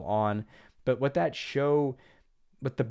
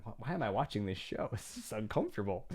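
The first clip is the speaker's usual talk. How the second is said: cringing aside; 6 dB quieter than usual. Retold or imitated. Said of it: "I just cringe and say".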